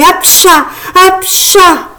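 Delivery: two high, explosive mock sneezes; acted sneezes. A narrator's reading. Frog fairy sneezing mid-dance, acted sneezes. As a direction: sneeze theatrically, twice over. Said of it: "voilà que j'éternue".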